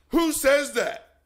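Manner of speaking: loud challenge. Real, not acted. Challenging Christians.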